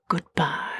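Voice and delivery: soft whispered farewell; whispering. Acted. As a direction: breathe goodbye in a whisper. Mermaid's farewell.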